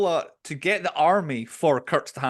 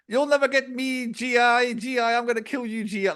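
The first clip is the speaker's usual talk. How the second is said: high, strained mock yell at the GI; a high, yelled battlefield taunt, imitated; imitating a shouting soldier; 9 semitones higher than usual. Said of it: "I like the guy shouting".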